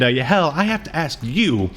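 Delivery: ordinary talk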